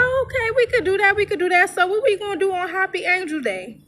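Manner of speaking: high, quick retold enthusiasm; playing along excitedly. Woman agreeing to the girl's Angel Day, excited.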